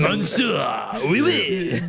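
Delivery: high, loud hammy French; mock French accent. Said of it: "French hats".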